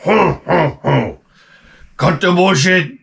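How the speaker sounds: a hint of a menacing warlord, slow; lightly voiced gruff warlord cutting off a laugh; Humongous. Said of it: "Humongous was like".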